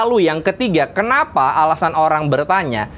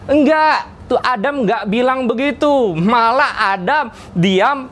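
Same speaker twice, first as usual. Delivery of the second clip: usual speaking voice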